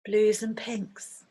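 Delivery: unhurried meditation guide, long pauses between phrases; guided meditation. Acted. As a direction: gently lead listeners through a visualization, leaving space after each phrase. Leads a guided visualization meditation.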